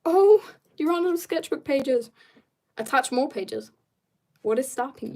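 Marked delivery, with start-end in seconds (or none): none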